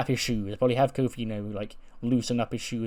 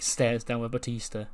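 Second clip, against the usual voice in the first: ordinary delivery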